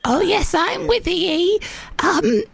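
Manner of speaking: put-on old lady phone voice; elderly grandmother. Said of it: "grandmother and granddaughter".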